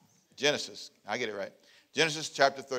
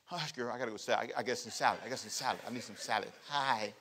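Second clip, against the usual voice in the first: sly whisper to friend; 6 semitones lower, 11 dB quieter, faster than usual. Acted. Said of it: "I love to say".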